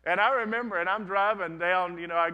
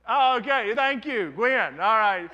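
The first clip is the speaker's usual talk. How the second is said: excited thanks; 5 semitones higher, 8 dB louder, slower than usual. Real, not acted. Answers a listener's call-out.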